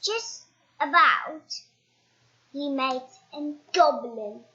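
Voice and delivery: ordinary delivery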